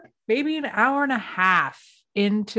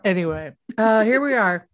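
normal talking voice

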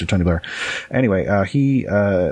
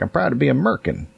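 a hint of a proud patriot; lightly voiced proud patriot; mock proud patriot; 5 semitones higher than usual. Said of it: "people say".

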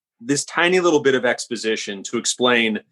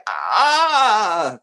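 long, high agonized "ah"; frustrated groan; 8 semitones higher than usual. Retold or imitated. Writer reacting to an actor's near miss, long frustrated cry. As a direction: draw out a mock cry of frustration. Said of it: "And I was like".

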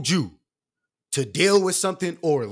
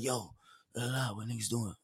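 much softer, low mumble; imitating low talker; 5 semitones lower, 13 dB quieter than usual. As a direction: imitate a friend who barely speaks up. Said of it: "I got friends that talk low".

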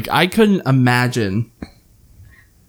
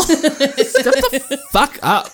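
quoting through laughter; 13 semitones higher, much slower than usual. Retold or imitated. Friends quoting Vine line, laughing.

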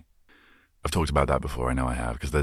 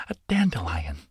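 whispered discovery; 3 semitones higher than usual. Pretend. Imitating Sid the Sloth.

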